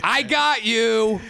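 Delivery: high, triumphant crowing; mock gloating. Trickster imagining taunting the fooled lady, gleefully gloating. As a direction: crow over your successful con, stretching the last bit.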